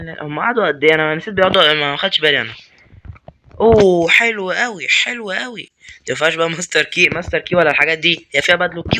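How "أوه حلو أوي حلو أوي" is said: delighted exclamation. Real, not acted. Pleased at find.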